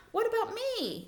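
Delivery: high-pitched, affronted protest; indignant. Shown as a demonstration. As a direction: act out being mad at being overlooked. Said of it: "saying it in a way like".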